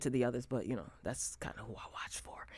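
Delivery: a hushed confession to the camera; whispered aside. Real, not acted. Vlogger to viewers, whispering a confession.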